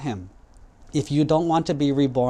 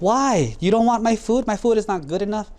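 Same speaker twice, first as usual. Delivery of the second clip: much higher, hurt and indignant; offended brother; 7 semitones higher than usual. Acted. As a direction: pitch it up, taking offence. Brother offended, food refused.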